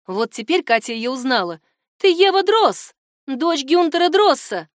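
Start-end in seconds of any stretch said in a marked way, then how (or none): 2.0-4.7 high, loud realisation; name her in raised, astonished voice; startled recognition. A narrator's reading. Katya suddenly recognises the girl.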